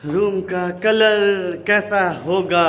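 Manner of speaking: loud, high, stretched-out question; drawn-out announcement. Real, not acted. Vlogger touring the rooms, drawn-out announcement. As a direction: draw every word out, high and loud.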